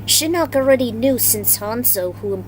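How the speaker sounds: usual speaking voice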